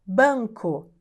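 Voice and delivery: normal talking voice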